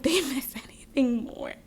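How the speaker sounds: breathy whispered aside with a giggle; hushed, giggling confession. Real, not acted. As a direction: whisper it like a secret. Aside about the ex-partner.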